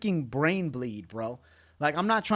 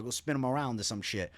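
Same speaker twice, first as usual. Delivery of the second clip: ordinary talk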